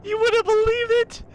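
very high shriek breaking into laughter; real jump-scare panic, squealing and half-laughing, half-crying; scared shrieking. Real, not acted. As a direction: shriek it way up high, slow and breathless with shock. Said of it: "That scared me so bad!".

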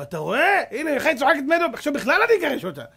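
loud, high indignant rant; indignant husband. Retold or imitated. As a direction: protest hotly to the rabbi. Husband angry at rabbi. (בעל כועס מתלונן לרב)

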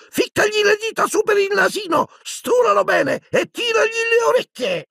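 very high, mischievous squeal; Peeves, shrieking. Acted. Poltergeist circles the lamp goading the elves, shrieking. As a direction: shriek instructions like a taunting imp. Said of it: "svolazzava attorno alla lampada strillando".

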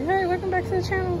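bright, quick vlogger greeting; chirpy channel intro, fast; youtuber intro voice. Shown as a demonstration. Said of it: "you, like, gotta do, like, an intro".

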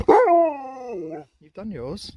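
high, drawn-out teasing to the dog; playful high voice telling the dog off. Real, not acted. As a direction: stretch it out high like teasing a pet. Teasing the dog about treats.

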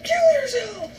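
high mock yell; imitated own yell. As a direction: mimic your own outburst way up high. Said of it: "I can't control what I yell. It's just like".

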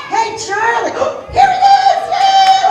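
loud high cheering shout; excited cheering. Real, not acted. Charlie finally appears.

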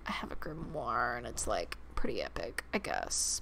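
very low, soft, husky monotone; quiet, low cartoon goth-girl impression; Raven from Teen Titans. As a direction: drop far down into a hushed deadpan. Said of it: "give her Raven's voice from Teen Titans".

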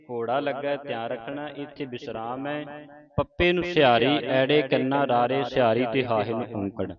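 ordinary talk, nothing marked

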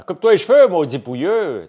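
loud, high scolding yell; shouting. Acted. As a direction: bark it at him, furious. Mock scolding of a scruffy guy.

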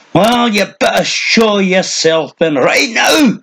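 put-on high, shrill old man yelling, slowed; cranky old hermit shrieking into the trees; high strident shout. A narrator's reading. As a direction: shout it up high and piercing at someone hidden. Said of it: "The voice was high and strident".

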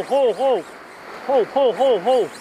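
ordinary delivery

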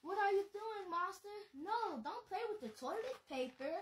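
grossed-out teasing scold. Acted. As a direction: tell the monster off playfully. Scolding monster at toilet.

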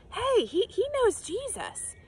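high, pointing-out call; accusing bystander. Acted. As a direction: tell everyone you've spotted him.

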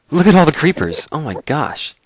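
high, loud astonished exclaim; surprised shout. Real, not acted. Player spotting a crowd of creepers, high astonished shout. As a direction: blurt it out in disbelief.

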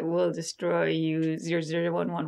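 flat monotone robot threat; toy robot voice. Pretend. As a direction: speak like a menacing toy robot. Playful robot voice sign-off.

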